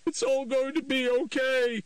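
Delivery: high, breaking, desperate reassurance; voice cracking. A narrator's reading. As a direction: soothe him while the voice breaks. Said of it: "her voice cracking".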